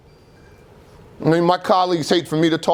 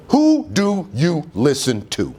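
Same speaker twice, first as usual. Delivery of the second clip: slow pointed question; slower than usual. Real, not acted. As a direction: ask it slowly, word by word. Slow first asking.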